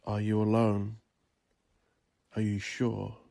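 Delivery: plain reading voice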